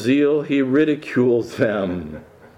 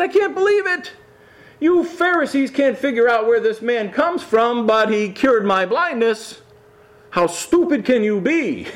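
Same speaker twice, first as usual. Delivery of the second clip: high, mocking disbelief; sarcastic; 9 semitones higher than usual. Healed man mocking the Pharisees, high sarcastic sneer. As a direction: play it as a sneering taunt. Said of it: "Notice the sarcasm in his remarks".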